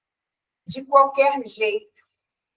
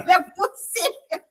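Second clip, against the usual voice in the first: laughing; 5 semitones higher than usual. Real, not acted. Laughs while saying it.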